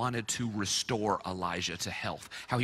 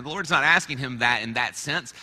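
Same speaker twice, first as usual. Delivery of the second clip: normal talking voice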